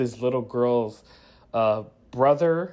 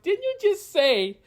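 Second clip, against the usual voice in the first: much higher and slow, openly disbelieving; mock outrage pitched way up; incredulous; 6 semitones higher, slower than usual. Reviewer mocking Kara breaking her no-kill rule, incredulous. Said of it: "I'm like".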